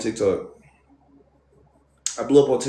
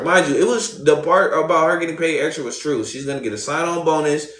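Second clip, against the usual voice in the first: ordinary talk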